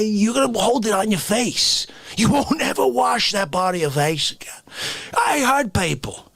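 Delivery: ordinary talk, nothing marked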